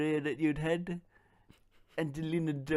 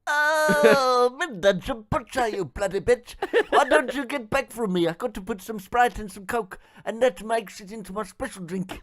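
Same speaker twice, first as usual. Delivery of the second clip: louder, higher hammed-up rant; mock ranting Depp; 5 semitones higher, 6 dB louder than usual. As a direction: swing from timid to furious Depp. Depp flips to rude persona.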